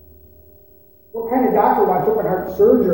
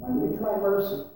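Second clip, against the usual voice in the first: softer, gentle "why don't you try mercy?"; 8 dB quieter than usual. Acted. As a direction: say "try mercy" softly, as a tender plea. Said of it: "he kinda said it like this".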